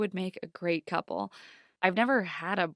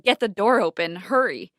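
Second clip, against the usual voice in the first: louder urgent order; kidnapper yelling; 8 dB louder than usual. A narrator's reading. Kidnapper urging his partner to hurry, loud. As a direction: bark it at your partner.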